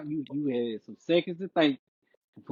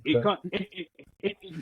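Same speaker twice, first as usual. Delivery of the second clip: laughing through the words; 5 semitones higher than usual. Real, not acted. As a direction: talk while cracking up. Laughing mid-sentence.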